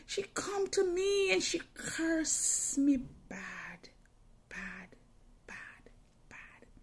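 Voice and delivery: slow, breathy hushed telling; whisper. Real, not acted. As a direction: whisper it like a secret dread. Hushed retelling of the dream.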